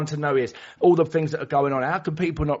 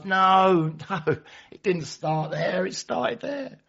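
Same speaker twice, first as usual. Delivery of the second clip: much higher, fussy correction with a laugh; correcting woman; 7 semitones higher, much slower than usual. Retold or imitated. Another woman correcting the member, fussy and very high. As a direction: butt in and correct them.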